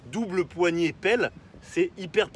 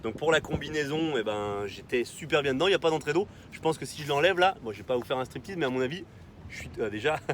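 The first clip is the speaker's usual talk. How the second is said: ordinary delivery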